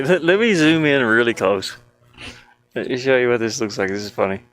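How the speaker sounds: ordinary talk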